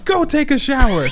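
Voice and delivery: high, loud order; bossy mom. Retold or imitated. Mom sending her hurt child to the shower, high and bossy. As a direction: shoo the kid off. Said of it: "she was like".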